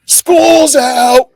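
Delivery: shouted high and gleeful; playful big shout; coy shout. Retold or imitated. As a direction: yell it like the last bell rang. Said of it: "I kinda coyly, I'm like".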